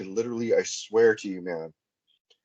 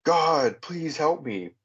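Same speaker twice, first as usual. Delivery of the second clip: raised, higher desperate cry to God; mild mock scream, higher; retold scream for help; 6 semitones higher, much slower than usual. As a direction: call out for help, pitched up. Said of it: "And I remember screaming".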